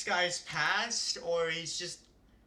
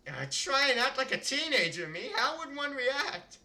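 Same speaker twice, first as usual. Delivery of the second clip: flustered inner thought; 7 semitones higher than usual. Acted.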